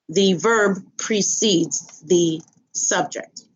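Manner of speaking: ordinary talk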